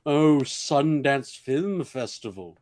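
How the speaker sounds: slow, drawn-out snooty drawl; snob voice. Retold or imitated. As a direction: mimic a pretentious film snob. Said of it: "big snobs and would be like".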